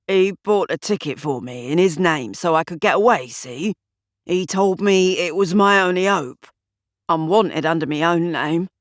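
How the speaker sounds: a hint of a worried London thug; lightly voiced thug. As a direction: mumble excuses, nervous.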